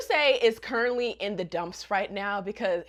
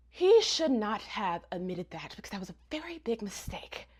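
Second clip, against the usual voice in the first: hushed warning to viewers; whispered aside; 2 semitones lower, 5 dB quieter than usual. Real, not acted. Vlogger confiding to viewers about the mistake, whispered. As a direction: lean in and whisper it. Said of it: "whisper heard, aside to viewers".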